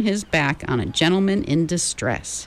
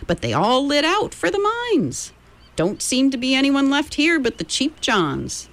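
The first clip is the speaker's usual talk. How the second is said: put-on old miner voice; 8 semitones higher than usual. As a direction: play a chatty gold-rush miner.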